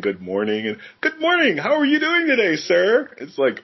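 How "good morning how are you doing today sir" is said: high, cheery mock greeting; smiling, friendly cop. Cop greeting a passer-by, smiling and chirpy. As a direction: imitate an over-friendly cop, pitched way up. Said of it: "waving and, and smiling and saying".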